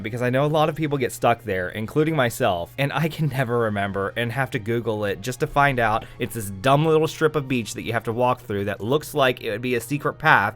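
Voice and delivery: usual speaking voice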